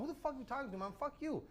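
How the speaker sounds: high-pitched retort, held down in volume; retold snapping. Driver snapping back at the cop, retold high indignation. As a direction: act out losing patience, voice jumping up. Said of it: "that's made me snap".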